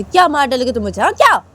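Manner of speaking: high, loud challenge; defiant girl. Acted. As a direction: dare him after the slap. Uma after slapping. (ऊँची, तेज़ ललकार)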